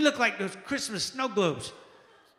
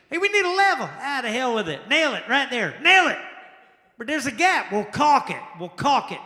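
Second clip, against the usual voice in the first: lazy worker; 5 semitones higher, 6 dB louder than usual. Acted. Acted careless bridge workers.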